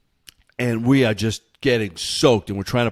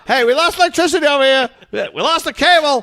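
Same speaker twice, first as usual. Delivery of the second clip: high, loud mock hollering; acted complaint shouts; 5 dB louder than usual. Neighbours yelling that services are out, mock shout. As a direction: call out comic complaints, one after another.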